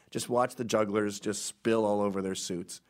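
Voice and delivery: ordinary delivery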